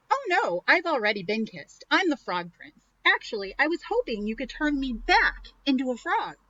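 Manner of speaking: eager prince asking a favor. Acted. Prince voiced in read story.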